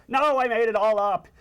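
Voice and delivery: high, loud cartoon protest; exasperated confession. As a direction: blurt it out to calm a panicking friend.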